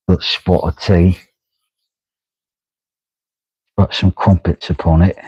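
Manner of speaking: understated mock British accent; put-on British accent. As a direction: play at being British, measured and easy. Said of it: "a hodgepodge of British movies".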